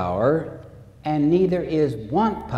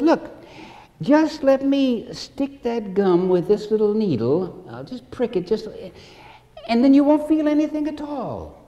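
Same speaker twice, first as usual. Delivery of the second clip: high-pitched, lightly voiced doctor's persuasion; raised, insistent reassurance; coaxing doctor; 8 semitones higher than usual. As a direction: imitate a doctor pleading with a scared patient. Doctor coaxing the scared patient.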